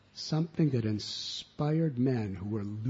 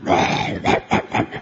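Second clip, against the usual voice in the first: lower, much louder rough breathy barks; bad dog growl; 7 semitones lower, 11 dB louder, slower than usual. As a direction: bark like a big mean dog. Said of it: "And the bad dog goes".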